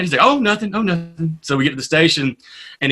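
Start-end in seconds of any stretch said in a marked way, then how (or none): 0.2-1.4 mock-innocent, high then dropping off; feigned innocence. Buddy hiding his prank from his friend, feigned innocence.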